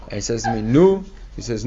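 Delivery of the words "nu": high, loud prodding "Nu"; a hint of an insistent old man; urging old man. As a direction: nudge him along impatiently. Man urging him to lay tefillin.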